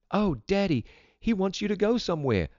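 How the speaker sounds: put-on high, excited girl; exclaimed. A narrator's reading. Vida tells Daddy what the dog wants, high exclamation. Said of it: "Vida exclaimed".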